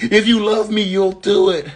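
high, loud guilt trip; acting demanding girlfriend. New girlfriend guilt-trips the flying monkey, high loud demand. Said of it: "you're acting and cracking me up".

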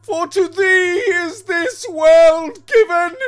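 high, slow, wailing shout; maddened despair. A narrator's reading. Husband gives himself up to the devil, maddened despairing shout. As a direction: cry out in despair, building to a full shout. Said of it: "maddened with despair".

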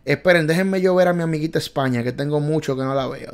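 ordinary talk

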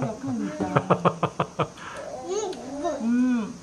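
talking while eating chicken; mouth full, chewing. Real, not acted. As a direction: chopsticks at the lips. Seen 2: Eats with chopsticks at lips, chewing while speaking throughout clip.

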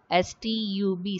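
letter by letter, evenly spaced; spelling out letters. Shown as a demonstration. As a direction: spell each letter out separately. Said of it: "the spelling of the word".

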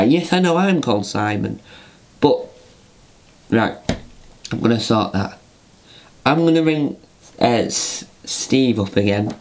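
usual speaking voice

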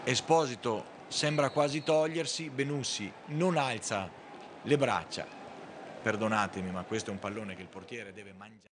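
ordinary talk

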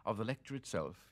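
ordinary talk, nothing marked

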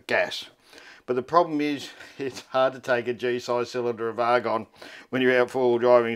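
normal talking voice

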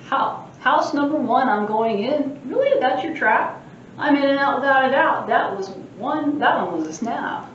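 a lightly voiced mischievous leprechaun boasting; cheeky leprechaun. Librarian reading the leprechaun's boasts aloud, cheeky. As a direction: tease the reader like a sprite.

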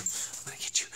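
soft whispered playful threat. Real, not acted. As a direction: breathe it like sneaking up on the dog. Chasing the dog, whispered.

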